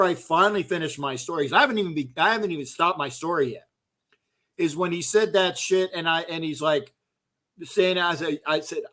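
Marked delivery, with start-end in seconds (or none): none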